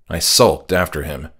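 plain reading voice

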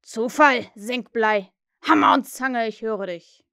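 loud, punchy pirate oaths; mimicking Hook. A narrator's reading. Peter answers Hook from hiding, mimicked captain's bark. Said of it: "antwortete ohne zu zögern mit Hooks Stimme".

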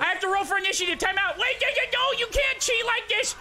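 very high frantic yelling; panicked falsetto shouting; mock panic. Jason attacks while rolling initiative, high frantic panic.

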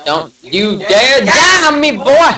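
high, loud hammed-up yell. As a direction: yell it slowly, climbing to a shout on the last word. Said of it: "you don't gotta yell".

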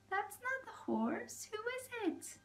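high, hushed frightened question; frightened thief. Acted. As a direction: whisper it in alarm. Thief startled by goose.

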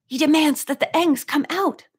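narrated panic, high and urgent; panicked. A narrator's reading. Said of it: "he asked in panic".

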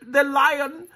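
high, desperate begging; crying plea. Acted. Boy begs the lion not to eat him, high and desperate. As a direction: plead high like a scared child.

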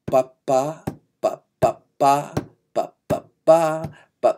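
repeated short-long-short "ba" pattern, long notes leaned on; syncopated rhythm syllables. Shown as a demonstration. Teacher getting students used to the pattern, rhythm chanted on ba. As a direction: drill the rhythm on ba into their ears. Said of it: "you get used to this thing".

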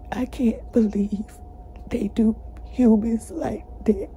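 hushed, shaken whisper; real whisper. Real, not acted. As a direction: drop to a whisper. Retelling abuse in hushed voice.

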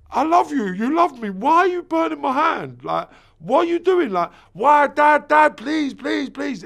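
put-on high, loud, begging child's cry; desperate child plea. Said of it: "I'm thinking".

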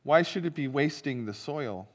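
ordinary delivery

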